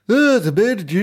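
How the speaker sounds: loud, high burst of mock Scottish; Scottish accent. Shown as a demonstration. Contrasting Scottish with Irish, loud high Scottish accent. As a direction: launch a brief Scottish impression. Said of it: "where Scottish is".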